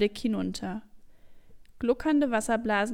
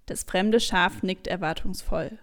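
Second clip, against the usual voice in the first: ordinary delivery, nothing marked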